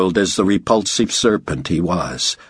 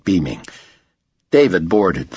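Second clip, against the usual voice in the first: ordinary delivery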